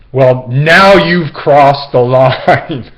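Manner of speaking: loud, slow, offended declaration; drawn-out, huffy scolding; mock-stern outrage at full volume; indignant wife. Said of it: "this person's wife said".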